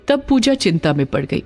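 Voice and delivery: ordinary delivery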